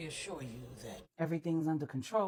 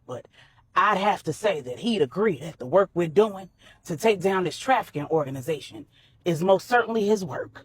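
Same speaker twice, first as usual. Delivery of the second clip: put-on a lightly lowered, firm older man; Manny, firm and protective; 10 dB louder than usual. A narrator's reading. Manny warns Corey his island is safe, lightly voiced and firm. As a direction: lay down the law on his own turf, steady.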